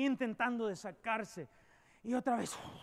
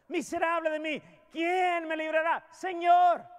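loud, pleading shout to God; a broken believer shouting for rescue; desperate cry; 4 semitones higher, 5 dB louder than usual. Acted. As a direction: cry out at the end of your rope. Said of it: "donde al fin decimos".

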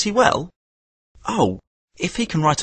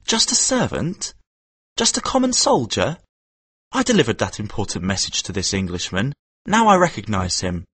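put-on higher, indignant boy's inner voice; a hint of a hurt, angry boy; indignant thoughts; 7 semitones higher than usual. A narrator's reading. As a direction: Kim stung and offended.

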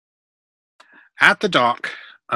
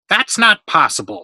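loud, higher-pitched protest; argumentative; 6 semitones higher, 9 dB louder than usual. A narrator's reading. Workman Saltos disputing the complaint, argumentative. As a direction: push back indignantly.

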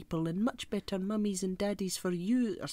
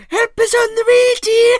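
put-on full-blast high scream in an old Scottish woman's voice; Missus Doubtfire; 17 dB louder than usual. Nanny rushing to the rescue, screaming in character. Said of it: "she's just screaming".

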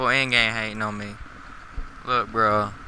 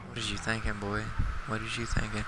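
half-asleep voice; 10 dB quieter than usual. Real, not acted. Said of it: "I don't know why I'm talking like this".

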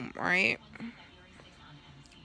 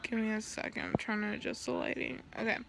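quiet aside; 7 dB quieter, faster than usual. Real, not acted. Aside while adjusting lighting.

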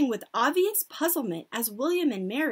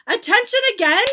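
high, loud call to order; shouted; 11 semitones higher, 13 dB louder, faster than usual. A narrator's reading. Grand High Witch calling witches to order, shouted. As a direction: shout for the room's attention. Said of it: "the Grand High Witch was shouting".

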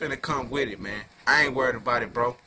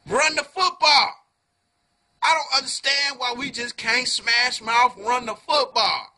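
raised, exasperated fan tirade; frustrated rant; 7 semitones higher, 4 dB louder than usual. Real, not acted. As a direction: let it out at the coach, high and loud. Frustrated rant about play calling.

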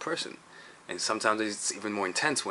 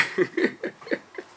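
high airy burst of laughter; quick unvoiced giggle; breathy laugh; 9 semitones higher than usual. Real, not acted. Laughs at own rambling.

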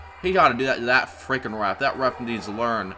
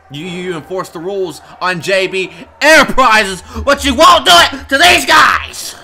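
indignant rant exploding into a yell; angry yelling; 6 semitones higher, 10 dB louder than usual. Real, not acted. Commentator berating the ref over unequal rules, yelling angrily. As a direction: build to a high, furious shout. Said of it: "I, I yelled".

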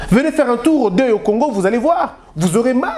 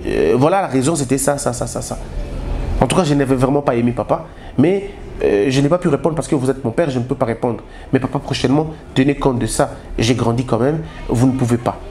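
much lower, measured and deferential; respectful child to father; 9 semitones lower than usual. Shown as a demonstration. Grown son calmly telling his father he felt hurt, low and deferential. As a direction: speak up to your father humbly but firmly. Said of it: "vous attendez et vous lui dites après".

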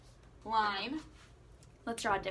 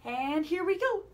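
higher, louder lead-in; high start; 6 semitones higher, 5 dB louder than usual. Shown as a demonstration. Teacher starting the slide line with kids, high lead-in. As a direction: launch from the top. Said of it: "breathe with me".

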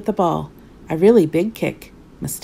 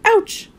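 sharp high yelp of pain; sudden little cry of hurt; pained ouch. A narrator's reading. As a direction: yelp as you hit the wall. Trips and falls into bath.

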